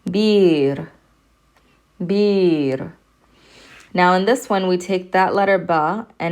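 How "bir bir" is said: Bir with a long i and a clear final r; long vowel demo. Shown as a demonstration. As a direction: hold the i in bir, then land on the r. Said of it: "That's an R sound at the end".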